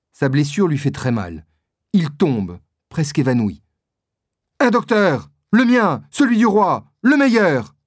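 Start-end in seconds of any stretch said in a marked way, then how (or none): 4.6-7.7 cris pressants, plus aigus et plus forts; crié. A narrator's reading. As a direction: appeler à l'aide en criant, affolé. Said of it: "crie monsieur de Tréville". (Tréville crie pour un médecin, voix haute et forte)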